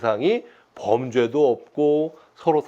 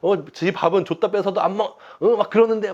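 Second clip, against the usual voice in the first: defiant talking back; 7 semitones higher than usual. Retold or imitated. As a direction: sulkily talk back to God like a cheeky kid. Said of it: "하나님한테 막, 응? 막 대드는 거예요".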